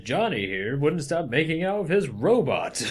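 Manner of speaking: fifties educational film narrator; old newsreel narrator. Pretend. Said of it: "quoting fifties film".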